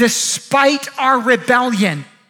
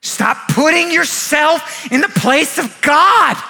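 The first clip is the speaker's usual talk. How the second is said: preaching at full force; 2 semitones higher than usual. Real, not acted. Preacher rebukes doubters who blame God, preaching at full force. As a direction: thunder each phrase at the congregation.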